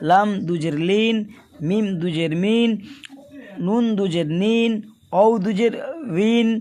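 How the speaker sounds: each letter then দুজের, ending in a clear ইন sound; tanween kasra drill. Shown as a demonstration. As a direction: chant the letters, closing each on ইন. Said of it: "এইভাবে পড়বেন".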